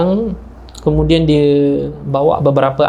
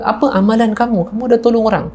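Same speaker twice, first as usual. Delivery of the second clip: high-pitched, acted interrogation; pitched-up, mildly urgent demand; insistent questioning; 8 semitones higher than usual. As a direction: imitate someone pressing for an answer. Rich man urgently questions Sayyiduk.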